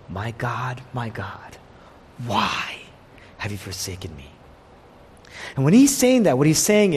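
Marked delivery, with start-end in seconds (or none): 0.1-4.3 the cry of forsakenness, said low and soft; low, hushed, broken plea; quiet anguished cry. Retold or imitated. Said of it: "then Jesus cried out".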